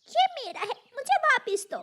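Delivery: put-on high, insistent child-bird voice; indignant demand. Little sister to the toy snatcher, indignant demand. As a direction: demand it back crossly.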